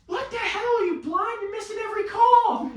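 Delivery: retelling his rant at the umpire; mock exasperated yell. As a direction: throw up your hands at the ump. Yelling at the umpire.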